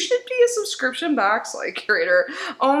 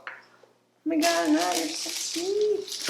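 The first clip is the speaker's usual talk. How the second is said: touched, soft thanks; 4 semitones higher, 6 dB quieter than usual. Real, not acted. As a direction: melt as you thank your friend. Overwhelmed by the gift.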